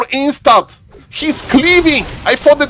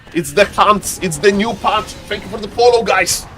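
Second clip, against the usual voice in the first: ordinary talk, nothing marked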